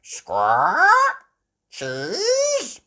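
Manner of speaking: very high, loud squawking parrot. A narrator's reading. Polly copying Granny, high squawking parrot. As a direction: screech it like a parrot mimicking. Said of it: "Polly Parrot copied".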